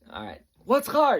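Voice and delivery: loud, high quiz question; drill sergeant voice. Retold or imitated. Drill sergeant testing the next private, loud shout. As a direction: fire the question down the line. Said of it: "they all talk like this".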